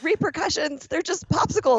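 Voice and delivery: high, disbelieving repeat of repercussions; stunned someone could get punished over this; incredulous. Retold or imitated. Customer stunned the agent mentions repercussions, high and incredulous. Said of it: "I'm like".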